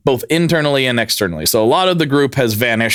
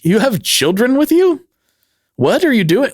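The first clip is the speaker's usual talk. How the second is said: incredulous locals; 10 semitones higher than usual. Retold or imitated. Kauai locals confronting the cult over children, mock incredulous. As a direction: play the shocked neighbour.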